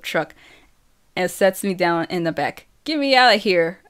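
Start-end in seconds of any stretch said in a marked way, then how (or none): 2.8-3.8 raised, higher-pitched demand; loud, insistent call; yell. A narrator's reading. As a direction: yell for someone to help you out. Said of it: "I yell".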